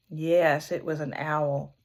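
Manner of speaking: plain reading voice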